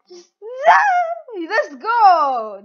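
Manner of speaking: high wailed no into a loud cheer; excited outburst. Real, not acted. Player in a tense game moment, shouted outburst. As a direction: wail it high, then shout triumphantly.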